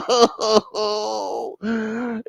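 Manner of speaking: long high theatrical sob; drawn-out high mock crying; mock wail. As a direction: wail it out in hammed-up grief. Caller breaks into wailing.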